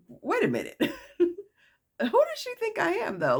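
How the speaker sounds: high-pitched laughing disbelief; incredulous. Retold or imitated. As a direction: balk in a squeaky, amused disbelief. Retold disbelief at being blamed.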